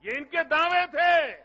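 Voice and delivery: ordinary talk, nothing marked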